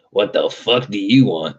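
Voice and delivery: a hint of a rude bartender; gruff bartender. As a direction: bark it like a fed-up barman. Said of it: "He's like".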